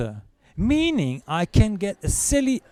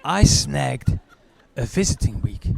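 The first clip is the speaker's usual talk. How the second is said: ordinary talk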